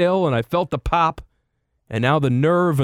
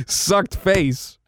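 ordinary talk, nothing marked

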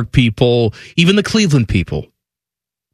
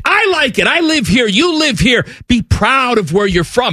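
fired-up pride; 8 semitones higher than usual. Real, not acted. Urging pride, raised voice.

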